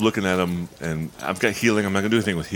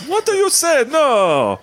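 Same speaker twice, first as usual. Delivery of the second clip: mock surprise; 6 dB louder, slower than usual. NPC feigns mock surprise.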